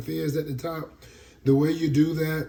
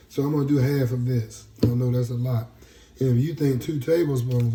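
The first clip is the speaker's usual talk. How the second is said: usual speaking voice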